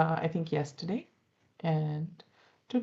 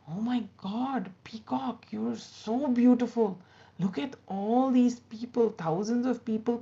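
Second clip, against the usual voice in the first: higher, louder, starry-eyed praise; gushing admiration; 6 semitones higher, 3 dB louder than usual. Acted. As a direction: gush like a smitten fan. Crow gushing over the peacock.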